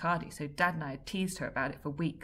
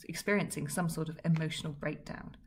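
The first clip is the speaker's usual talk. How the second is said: usual speaking voice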